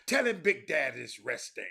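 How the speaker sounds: loud hollered reply; Powell hollering back. Retold or imitated. Powell hollers down to the boy, loud. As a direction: holler it down the stairs like Big Daddy. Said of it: "That's how he talk".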